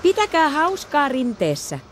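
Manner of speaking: high, slow cheerful farewell call; a parent's cheery goodbye. Acted. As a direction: call out brightly to the kids. Parent voiced calling goodbye.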